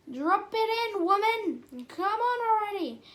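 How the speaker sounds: high, loud impatient order; exasperated shout. Acted. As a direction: yell the command at a hesitant pilot.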